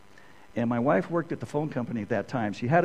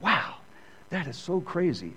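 amazed wow; 6 semitones higher than usual. Retold or imitated. Amazed reaction while studying Scripture.

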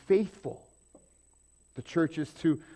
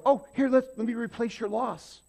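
meddling helper; 9 semitones higher, 4 dB louder than usual. Retold or imitated. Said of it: "we step in and go".

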